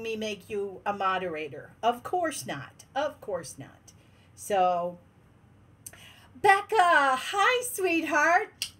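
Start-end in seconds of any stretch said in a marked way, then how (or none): 3.0-3.9 resigned mutter to herself; muttered aside. Real, not acted. Repeats exasperated remark to herself. 6.4-8.7 higher and slow, drawn out fondly; slow affectionate hello; tender greeting. Real, not acted. Greeting a beloved viewer.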